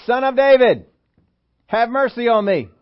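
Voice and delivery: high, loud desperate call; crying out for mercy. Acted. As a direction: Bartimaeus calling out to Jesus, loud and pleading. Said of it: "he's making a point to say".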